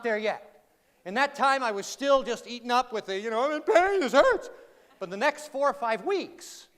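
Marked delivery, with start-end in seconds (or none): 3.4-4.5 higher, louder mock complaint of pain; mock pained cry. Injured speaker to himself, mock pained complaint. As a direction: whine about the hurt, half in jest. Voicing his own pain then.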